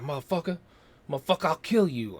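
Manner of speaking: a touch of mock fury, fast at the end; tossed-off threat, mildly hammed; mock rage. Reviewer retelling Seagal's revenge threat, mild mock anger. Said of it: "he's like".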